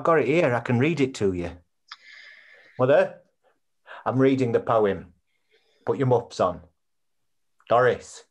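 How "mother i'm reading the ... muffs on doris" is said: calling out. Acted. Calling Mother to listen.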